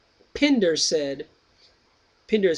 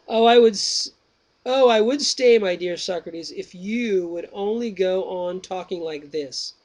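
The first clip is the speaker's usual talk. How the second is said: slow, raised reading voice for the dialogue; dramatic reading; 3 semitones higher, 4 dB louder, slower than usual. Acted. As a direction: read the old text out slowly and grandly. Reads Plato dialogue aloud.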